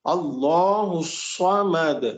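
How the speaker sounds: very slow الصمد with a thick, heavy صاد; heavy saad. Shown as a demonstration. Teacher correcting the student's صمد. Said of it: "اس کو سین سے نہیں".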